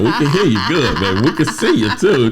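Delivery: high, laughing tease; high teasing voice. Retold or imitated. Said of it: "And then she said".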